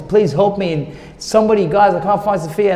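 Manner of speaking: mildly panicked retold call for help; retold cry for help. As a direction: plead urgently, as if calling out in a retold dream. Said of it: "I'm screaming for help".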